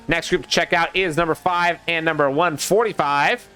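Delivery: normal talking voice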